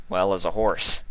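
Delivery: plain reading voice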